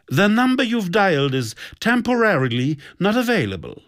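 high, sugary recorded-announcement voice; sugar-sweet, icy. A narrator's reading. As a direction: play a syrupy, icy telephone announcement. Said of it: "in zuckersüßem, geeistem Ton". (zuckersüß und eisig)

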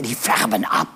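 the low clerk repeating, incredulous; low salesman voice. Acted. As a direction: echo it low in disbelief. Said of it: "sagt der Verkäufer".

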